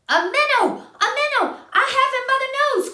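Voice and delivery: high, loud gleeful cry of a catch; a frog crying out in delight; excited cry. Acted. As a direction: shout it like a thrilled little fisherman. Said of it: "cried Mister Jeremy Fisher".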